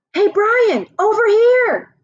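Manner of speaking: high, loud call across the room. Acted. Justin calls across the lunchroom to invite Brian over, high and loud. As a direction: wave him over with your voice.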